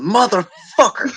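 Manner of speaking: loud drawn-out curse shout; mock stadium yell; imitated yell. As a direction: bellow it like the wrestler on TV. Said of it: "M J F going".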